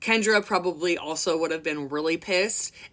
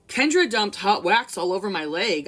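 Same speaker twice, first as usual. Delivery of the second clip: plain reading voice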